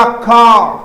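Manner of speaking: कार said as kaa, no r at all; car without r. Shown as a demonstration. Teacher demonstrating the correct कार. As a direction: drop the r in कार, long a. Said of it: "कार नहीं होगा".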